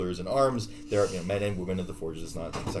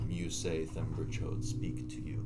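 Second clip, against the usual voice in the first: solemn priest; 5 semitones lower, 9 dB quieter, slower than usual. Acted. GM voices priests.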